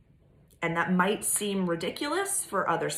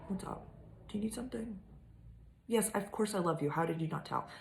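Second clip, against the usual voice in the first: much softer, cooing aside to a pet; soft pet talk; talking to the cat; 3 semitones higher, 8 dB quieter, faster than usual. Real, not acted. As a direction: murmur gently to the cat.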